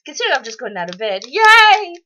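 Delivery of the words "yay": high, loud cheer; a burst of glee; real excited cheer; excited yay. Real, not acted. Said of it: "I'm sorry if I'm a little bit excited".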